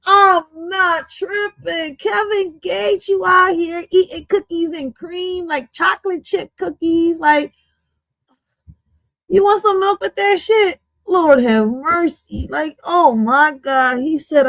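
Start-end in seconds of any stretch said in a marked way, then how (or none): none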